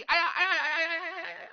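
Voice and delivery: hammed-up squeals of pain; pained yelps. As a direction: yelp as the wrist is crushed. Wrist squeezed in a vice grip.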